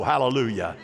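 normal talking voice

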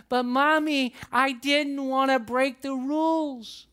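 child on the verge of tears, mildly voiced; upset little girl. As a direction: plead like a worried little girl. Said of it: "She was so upset".

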